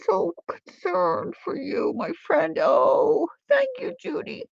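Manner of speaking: high, excited and tender; emotional delight. Real, not acted. Crafter gushing to her friend Judy in the chat. Greeting a long-missed friend.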